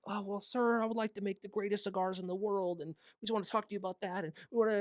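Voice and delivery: a starstruck fan blurting out his big dream; eager starstruck pitch. Retold or imitated.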